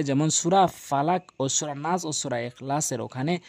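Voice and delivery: ordinary talk, nothing marked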